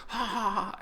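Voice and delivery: very high manic mock laugh; imitated maniacal Joker laugh. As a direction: shriek out the Joker's cackle. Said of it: "it reminded me so much of the Joker".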